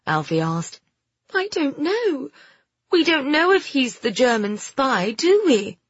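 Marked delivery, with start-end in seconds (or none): 1.3-5.8 put-on high, unsure girl's voice; girlish doubt, seeking agreement; doubtful girl Phoebe. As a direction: protest uncertainly, pitched up. Story figure, uncertain girl.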